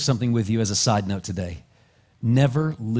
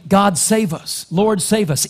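crying out; 7 semitones higher, 4 dB louder than usual. Retold or imitated. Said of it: "cried out, saying".

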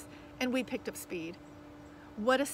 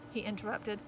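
ordinary delivery

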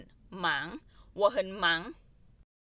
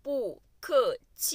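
syllable by syllable; 3 semitones higher, much slower than usual. Shown as a demonstration. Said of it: "syllable drill in lesson".

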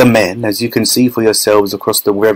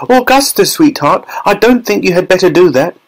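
put-on high-pitched mother calling to her child; high maternal warning; Missus Gloop; 8 semitones higher than usual. A narrator's reading. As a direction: warn your darling son in a high voice. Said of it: "shouted Missus Gloop".

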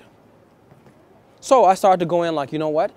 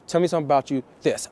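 fast, hurried opener to a woman; fast and rushed pickup line; rushed fast pitch; faster than usual. Retold or imitated. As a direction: rattle it off, rushing her. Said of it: "I used to be fast like that".

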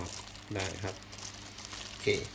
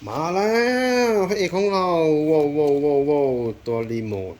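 much higher and louder, thrilled; excited reveal; 5 semitones higher, 7 dB louder than usual. Real, not acted. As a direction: announce the star product with delight. Unveiling the star product.

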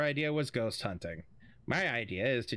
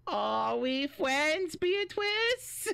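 slow, high teasing question; teasing singsong; 12 semitones higher, much slower than usual. Acted. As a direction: drag it out to tease. Teasing the friend.

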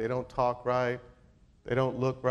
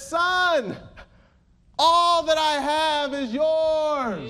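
high, loud, drawn-out pulpit cry; ringing preacher's climax on the father's promise; proclaiming father; 9 dB louder, much slower than usual. Acted. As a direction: proclaim it slowly at full voice.

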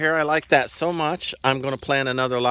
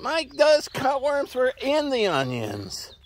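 mock neighbour; 10 semitones higher, slower than usual. They say plant onions.

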